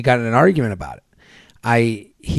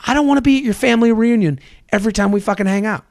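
ranting retort; 6 semitones higher than usual. Retold or imitated. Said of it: "I go".

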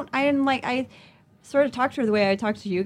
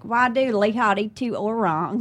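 put-on slow Indonesian in a thick American Southern accent; heavily American-accented Indonesian; distorted Indonesian; slower than usual. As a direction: drawl the Indonesian like a Texan. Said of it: "it's very distorted Indonesian".

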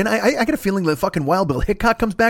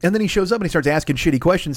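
ordinary delivery

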